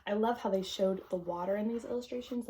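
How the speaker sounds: usual speaking voice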